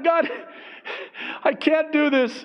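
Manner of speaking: very high, helpless plea; mock wail of giving up; acted despair. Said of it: "So it's like".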